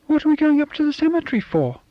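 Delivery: put-on a child whining at her dad, pitched high; small girl voice. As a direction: voice a fussy little girl. Child Sonia questions.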